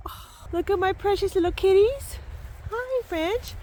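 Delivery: high, loud cooing pet voice. Real, not acted. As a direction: coo at the cats like babies. Cooing to her cats.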